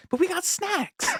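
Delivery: high, strained whine; Casey Affleck impression. Running bit with college buddies, high strained whine. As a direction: squeak it out like a kid defending the snacks. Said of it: "food came first".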